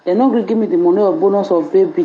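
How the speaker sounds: normal talking voice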